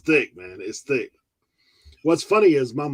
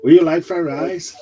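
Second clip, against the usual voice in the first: mock Chinese accent; 3 semitones higher, 8 dB louder than usual. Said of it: "I talk with Chinese".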